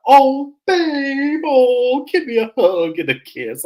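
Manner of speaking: high, loud gushing lover; hammed-up lovey-dovey swooning; mock her fawning over her man, high and loud; goofy mock Stacey. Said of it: "Stacey with her old goofy ass".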